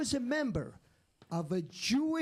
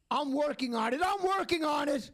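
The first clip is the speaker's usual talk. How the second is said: mother's retort rising to a shout, a bit higher; mock snapping mom; 5 semitones higher, 3 dB louder than usual. Comic's mother snapping at her seven-year-old, mock shout. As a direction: bark it back like an exasperated mother.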